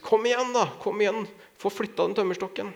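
high-pitched mock bellow, no real volume; gjenfortalt brøl. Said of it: "brølte ut". (etterlignet, dempet sersjantbrøl)

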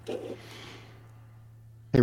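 whispered aside. Real, not acted. Aside mocking the caller.

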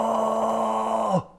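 long high roar at a mountain; retold roar to the mountain. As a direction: let out a big primal roar. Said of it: "and I went".

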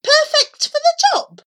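very high, loud disbelief; outraged echo. A narrator's reading. Child repeats teacher's verdict, high, loud and outraged. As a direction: throw the words back incredulously.

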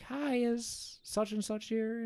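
put-on high, slow, hesitant young woman; meek girl asking at the party; timid cashier voice. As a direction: tiptoe in with a small high voice. Cashier voiced in retold story.

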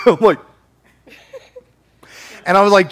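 ordinary talk

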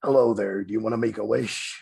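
a hint of a low, creepy puppet voice; lightly voiced sinister invitation; creepy Saw voice. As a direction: lean in like a horror villain. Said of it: "That's the guy from Saw".

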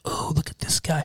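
hushed, whispered swoon of admiring moms. Retold or imitated. Podcaster imitates movie moms admiring a swimmer, hushed whisper. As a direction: whisper it like moms ogling a good-looking man. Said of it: "all the moms are like".

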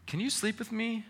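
put-on high, small child asking; pleading child voice. Father voicing his son asking to sleep with him, high and small. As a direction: ask like a little boy who wants company. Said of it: "And he goes".

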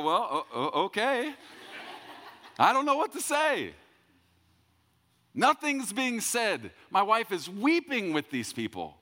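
very high, loud mock squeal; comic flustered panic. Preacher left out of the weeping hug, mock panic. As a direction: squeak it out, hammed-up and helpless.

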